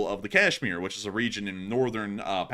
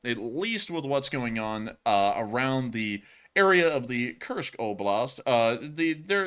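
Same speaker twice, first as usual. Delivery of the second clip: ordinary talk, nothing marked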